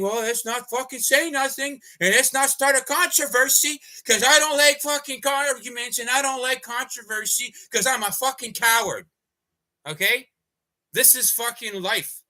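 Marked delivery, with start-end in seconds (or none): 0.0-9.1 high, whiny mock voice of a timid bystander; imitating people who won't speak up, pitched up and whiny; mocking cowardly bystander. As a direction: sneer at them in a higher put-on voice. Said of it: "around them saying".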